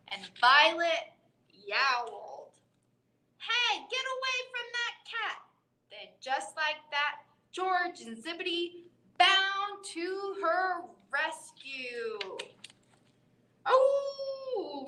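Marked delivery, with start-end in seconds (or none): 3.4-5.4 high bird voice telling off; bird shouting. Acted. George the bird chases off the dogs, high voice. 13.7-14.9 high bird cry. Acted. George the bird calls out during the rescue, high.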